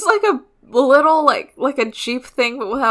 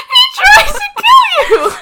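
laughing outrage; 7 dB louder than usual. Real, not acted.

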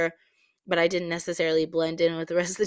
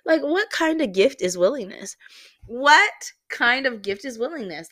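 indignant, higher and louder, in disbelief; retold anger; 7 semitones higher, 6 dB louder than usual. Venting at home about being called willing, acted anger. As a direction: act out exasperated, raised-voice outrage. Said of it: "I would go home and be so angry".

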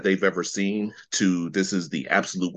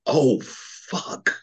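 shocked; 5 semitones higher than usual. Retold or imitated. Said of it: "I was like".

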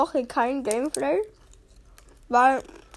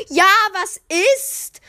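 higher, louder drawn-out shout back; mock yell; 7 semitones higher, 6 dB louder, slower than usual. Son yelling back at his mother, drawn-out shout. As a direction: yell back annoyed from your room. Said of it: "schrei ich halt so".

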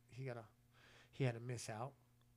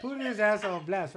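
laughing; 6 semitones higher, 11 dB louder than usual. Real, not acted.

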